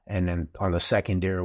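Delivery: ordinary talk, nothing marked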